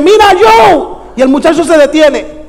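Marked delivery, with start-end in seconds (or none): 0.0-0.9 high shouted call to a stranger. Retold or imitated. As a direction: yell the name across the tent. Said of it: "Yo dije".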